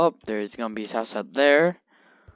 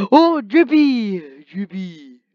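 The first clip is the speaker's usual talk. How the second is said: excited exclaim; 7 semitones higher, 11 dB louder, slower than usual. Real, not acted. Excited at dog's new look.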